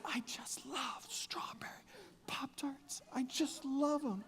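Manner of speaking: hushed, drawn-out whisper; exaggeratedly gentle. Husband keeping peace at home over Pop-Tarts, gentle slow whisper. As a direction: whisper your enthusiasm slowly, holding all the power under. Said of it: "this is gentle".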